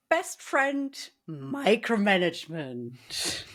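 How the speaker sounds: slow, drawn-out mock fondness; drawn out with heavy irony; stark sarcasm. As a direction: introduce it sarcastically like a dear friend. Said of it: "stark sarcasm in my voice".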